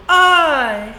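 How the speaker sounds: high, loud crying whine. Shown as a demonstration.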